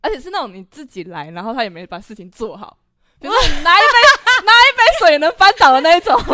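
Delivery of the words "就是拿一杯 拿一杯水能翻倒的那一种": talking doll. Retold or imitated. Mocking helpless girl act.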